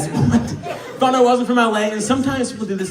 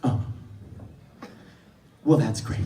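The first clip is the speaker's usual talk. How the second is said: low, soft deadpan with long pauses; understated, withering politeness; unimpressed response; 10 semitones lower, 9 dB quieter than usual. Retold or imitated. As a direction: imitate a listener killing the excitement. Girl's underwhelmed response to story.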